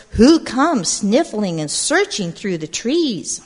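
put-on lively read-aloud to a group of kids; storybook narration. A narrator's reading. Storyteller reading to gathered children, lively narration. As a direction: tell it like a picture book at storytime. Reads picture book aloud.

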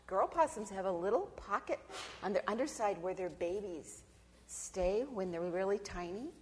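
usual speaking voice

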